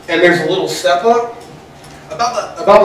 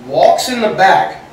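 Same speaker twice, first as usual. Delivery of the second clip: ordinary talk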